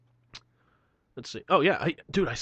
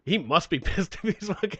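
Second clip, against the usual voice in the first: higher, laughing through the words; 5 semitones higher than usual. Real, not acted. Joking about the angry misspelt email, laughing. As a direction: try to talk while cracking up.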